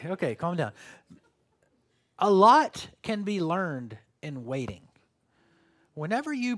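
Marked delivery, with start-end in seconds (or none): none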